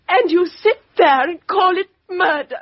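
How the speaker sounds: high, breaking, near tears; tearful outburst. Acted. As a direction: cry out at the injustice, voice cracking. Woman defends friend's revenge.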